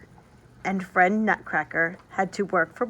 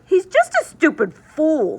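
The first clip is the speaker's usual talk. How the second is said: put-on high, loud sneering boy voice; scornful boy; 8 semitones higher, 7 dB louder than usual. Fritz mocks the broken Nutcracker, loud sneering boy. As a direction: sneer like a rude little brother. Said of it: "said Fritz".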